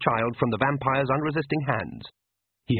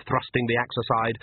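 usual speaking voice

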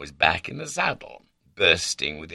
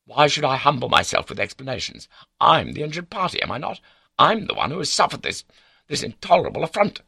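high, loud, affronted protest; injured indignation; 11 semitones higher, 5 dB louder, faster than usual. A narrator's reading. Baronet refusing to humble himself, indignant. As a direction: protest as the wronged party.

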